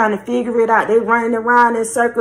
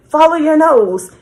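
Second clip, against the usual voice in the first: quoted cereal-mascot slogan, a bit higher and louder; Toucan Sam slogan; 5 semitones higher, 4 dB louder than usual. Retold or imitated. Quoting Toucan Sam's slogan to the listener, mildly sing-song. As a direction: lightly chant the mascot's catchphrase. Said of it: "That's his saying".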